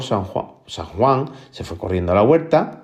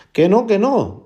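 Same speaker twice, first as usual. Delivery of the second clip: high-pitched, acted obstinate refusal; stubborn refusal; 11 semitones higher, 4 dB louder, slower than usual. Juan refuses to dig for the man, acted stubbornness. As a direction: dig your heels in, voice jumping up. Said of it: "viendo la terquedad de Juan".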